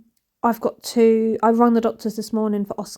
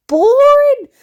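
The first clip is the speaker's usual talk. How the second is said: boring shot up high and loud, then falling; mock exaggerated boring; 9 dB louder than usual. Real, not acted. Mocking her own filming as dull, exaggerated high and loud. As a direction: overplay the word boring as a joke. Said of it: "it's so".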